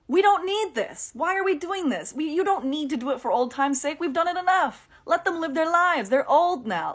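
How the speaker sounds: a fed-up fan pleading with the writers; exasperated rant. Real, not acted. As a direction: beg the writers to stop. Ranting at the show.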